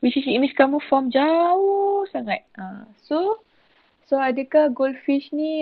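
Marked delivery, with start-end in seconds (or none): 1.1-2.4 "jauh" held long, rising in pitch; drawn-out emphasis. Real, not acted. Teacher saying where the image forms, drawn-out emphasis on "jauh". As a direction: draw out "jauh" to show great distance. Stretches jauh to stress great distance.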